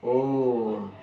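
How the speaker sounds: ओम stretched very slowly; drawn-out om. Shown as a demonstration. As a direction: hold ओम long like a call. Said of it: "जैसे हम बात करें ओम". (लंबा खींचा हुआ ओम)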